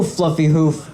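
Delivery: normal talking voice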